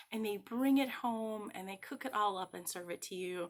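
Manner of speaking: normal talking voice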